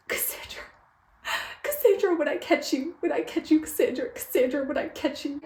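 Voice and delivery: whispered name, then high repeated threat; theatrically aggrieved, high-pitched menace; mock threat. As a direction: chant a playful vow of revenge.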